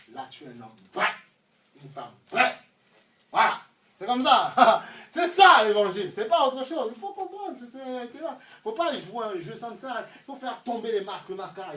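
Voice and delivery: loud, climbing sermon voice; full-force preaching. Acted. Preacher insisting on true living, loud preaching. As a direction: drive the point to the back rows.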